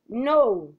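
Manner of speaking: "No" with a pronounced falling tune. Shown as a demonstration. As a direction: let "No" drop firmly in pitch at the end. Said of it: "falling tone".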